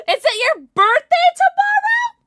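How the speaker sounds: gasp, then a high, loud, drawn-out excited question; excited surprise. Real, not acted. Streamer learning of a viewer's birthday, thrilled.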